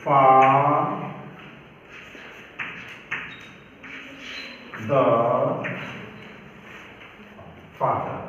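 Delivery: फा- then द- split apart, low and slow, then फादर; syllable by syllable. Shown as a demonstration. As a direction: break फादर into syllables for the class. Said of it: "देखो". (फादर को धीमे, टुकड़ों में, नीचे सुर में बोलना)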